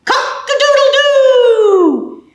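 high, slow rooster crow. Acted. Rooster crow in picture book.

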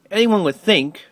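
plain reading voice

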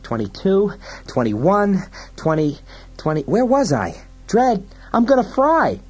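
high, frantic counting and wailing; panicked sidekick. Acted. Fergie counts down to the fireball, high panic.

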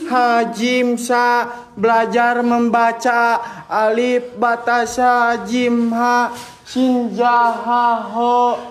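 slow, low, measured chant; low learner's recitation, syllable by syllable; reciting the alphabet. Pretend. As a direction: sound out each letter slowly. Claims to be reading Arabic letters.

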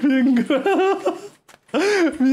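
breaking into laughter mid-word; laughing. Real, not acted. Streamer laughing at a chat story, cracking up. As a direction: laugh through the words.

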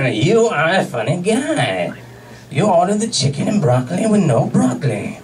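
hammed-up French waiter with a laugh; exaggerated French-accented waiter; mock French accent. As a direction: do a deliberately bad French accent. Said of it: "horrible Chinese accent, but fuck the French".

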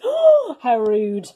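plain reading voice

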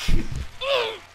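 a squealing laugh up high; high burst of laughter. Real, not acted. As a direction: break into a sharp laugh. Laughs after killing his boy.

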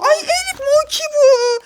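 high, slow delighted exclamation; thrilled by the gift; excited. Real, not acted. Opens the surprise package. (heyecanlı, sevinçli ünlem)

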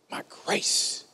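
breathy whisper, slow; whispered verse. Acted. Pastor whispers God's reply to Paul, hushed. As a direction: whisper God's words close to the mic.

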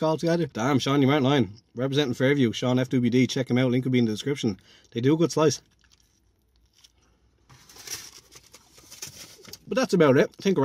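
talking between bites of pizza; eating while talking; mouth partly full. Real, not acted. Seen 1: bites slice at start, then talks holding pizza near mouth.

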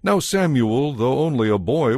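ordinary delivery

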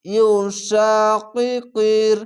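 very slow, level chanted word; Quran recitation. Shown as a demonstration. Teacher modelling a word for students, slow recitation. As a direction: stretch each long vowel in tajweed style.

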